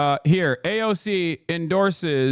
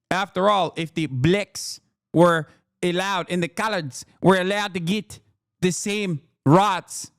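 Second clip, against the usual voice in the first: put-on South African accent. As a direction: put on a mock Afrikaner accent, slowing on the last words. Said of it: "Was that a good South African accent".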